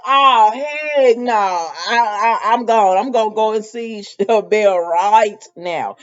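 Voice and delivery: loud, drawn-out refusal building into resolve; mock outrage. Sean hearing where she is, loudly outraged. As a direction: belt out an indignant imitated reaction. Acting Sean's outraged reaction.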